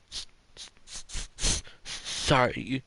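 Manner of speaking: nervous stutter on the s; stumbling over the first sound; stammering. Acted. Startled girl stammers apology.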